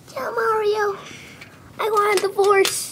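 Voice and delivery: a touch of a fading princess; Peach's last words. Acted. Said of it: "Peach wants to say her last words".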